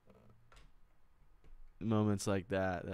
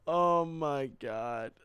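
stunned; 3 semitones higher, 4 dB louder, much slower than usual. Real, not acted. Overwhelmed after sweet fan chat.

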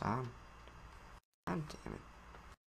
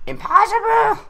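much higher, much louder yell of disbelief; frustrated shout; 12 dB louder than usual. Real, not acted. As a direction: blow up at the game. Frustrated outburst at game.

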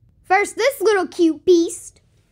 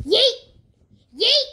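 excited yeet; 3 semitones higher, 4 dB louder than usual. Real, not acted. Throwing toy, exclamation.

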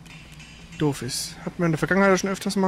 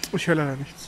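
usual speaking voice

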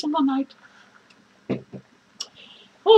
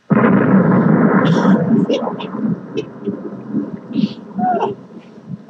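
long wheezing laugh; 8 semitones higher than usual. Acted. Clown laughs at own joke.

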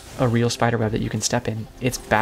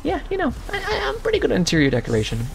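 sarcastic; 3 dB louder than usual. Pretend. Joking about fireball decor.

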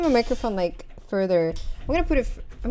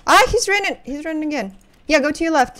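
raised, high-pitched alert to a teammate; urgent callout; 5 semitones higher, 10 dB louder than usual. Real, not acted. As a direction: call it out loud mid-chase. Calling out a running enemy.

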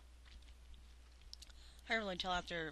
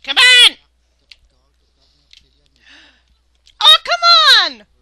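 loud high exasperated yell; frustrated shout; 29 dB louder, slower than usual. Real, not acted. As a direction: yell at the game in dismay. Shouts at game.

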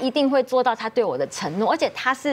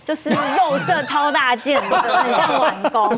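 laughing while describing; 4 semitones higher, 4 dB louder, slower than usual. Real, not acted. Laughing describing granny underwear.

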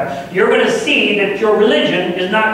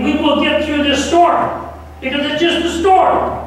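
rising preacher climax; 6 semitones higher than usual. Real, not acted.

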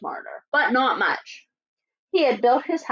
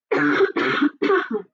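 cough; 2 semitones lower than usual. Real, not acted. Wolf coughs with cold.

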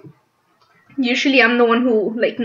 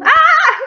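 high, loud shriek; scream; 9 dB louder than usual. Real, not acted. Player in trouble in the game, laughing scream. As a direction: shriek loudly, then break into laughter. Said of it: "Sorry for the screaming".

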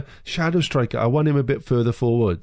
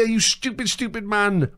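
high, anguished scolding; high-pitched wail of frustration; exasperated outburst; 8 semitones higher than usual. Real, not acted. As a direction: berate him in a raised, despairing pitch. Berating the player after miss.

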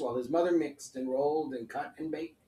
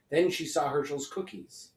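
usual speaking voice

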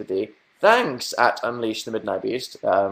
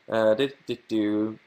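ordinary delivery

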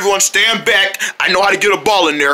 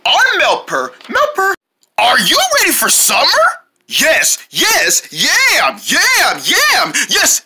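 excited high, swooping promo voice; promo announcer; 9 semitones higher, slower than usual. Acted. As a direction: hype the summer shows. Channel promo.

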